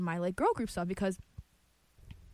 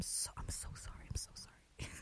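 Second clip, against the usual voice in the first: whispering; 14 dB quieter than usual. Real, not acted. Apologises after slamming the microphone.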